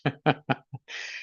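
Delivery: soft amused laugh; real chuckle. Real, not acted. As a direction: chuckle quietly at the movie. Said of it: "I'm laughing because this movie made me laugh".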